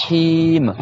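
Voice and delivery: Him, slow, breathed H and long i; prolonged i. Shown as a demonstration. Teacher showing students Him with a long i. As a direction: stretch the i of Him. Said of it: "avec le i prolongé".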